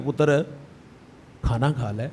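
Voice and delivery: put-on soft, low and tender, like coaxing a child; sweet words. Preacher retells the kind invitation to the sweeper, softly tender. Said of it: "صرف دو میٹھے بول".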